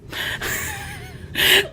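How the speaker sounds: high squealing laugh while reading; helpless high-pitched giggle; breaking into laughter. Real, not acted. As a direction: crack up mid-story at the naughty part. Laughs at own story.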